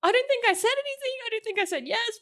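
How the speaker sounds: reliving it in a tiny high-pitched voice; overwhelmed squeal. Retold or imitated. Telling friend how she answered the proposal, overwhelmed squeal.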